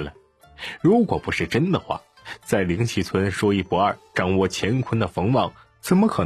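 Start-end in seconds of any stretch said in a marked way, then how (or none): none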